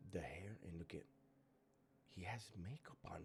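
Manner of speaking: whispered shock. Real, not acted. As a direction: hush it out, stunned. Hushed shock at his look.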